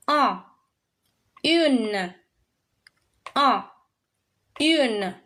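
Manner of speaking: Un nasal, Une with a clear N; un versus une. Shown as a demonstration. Teacher drilling un and une in turn. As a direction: alternate Un and Une, making the N heard. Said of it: "So this is how it's read".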